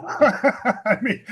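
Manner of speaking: bursts of high laughter mid-sentence; real laughter. Real, not acted. Delighted guest laughing about his new goggles. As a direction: laugh out loud at how good it is.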